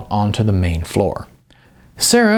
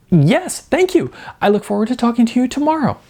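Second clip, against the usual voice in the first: thrilled thanks; 12 semitones higher than usual. A narrator's reading. Thrilled on the phone.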